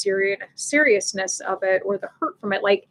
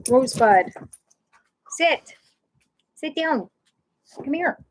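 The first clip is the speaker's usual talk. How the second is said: short, higher-pitched commands to a pet; dog commands; 5 semitones higher than usual. Real, not acted. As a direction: call the dog to heel, pitch lifted.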